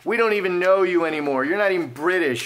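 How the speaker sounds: boo them like a hostile crowd; jeering crowd. Pretend. British public rejecting the returning couple, mock jeering. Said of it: "will the British people be like".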